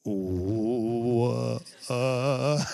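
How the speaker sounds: stretched, drawn-out half word; comic slow-motion start; slowed-down question. Retold or imitated. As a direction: ooze the word out very slowly. Starts the slowed question once more.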